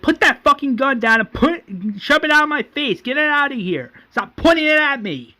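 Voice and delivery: high-pitched angry mock scream; retold scream. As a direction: yell it as a frantic acted order. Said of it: "Then Daryl screams".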